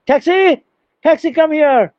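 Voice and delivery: hailing a taxi. Acted. Teacher shows students how one hails a cab, calling out. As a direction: call out briskly as if flagging down a taxi.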